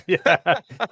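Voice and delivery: yeah said through real laughter; laughing. Real, not acted. Co-host laughs at the host's murder slip, amused.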